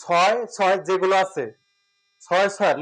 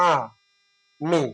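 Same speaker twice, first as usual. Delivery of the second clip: syllable by syllable; 3 semitones lower, slower than usual. Shown as a demonstration. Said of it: "মুক্ত অক্ষর বা বাক্যটা নির্ণয়".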